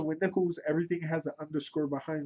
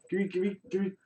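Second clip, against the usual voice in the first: ordinary talk, nothing marked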